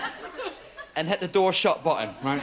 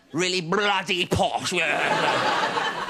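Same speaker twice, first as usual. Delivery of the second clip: slow, drawn-out mock posh accent; posh accent as if unable to breathe in; breathless posh accent; much slower than usual. Mocking his mate's girlfriend, breathless posh accent. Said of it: "Sounds like she can't breathe in".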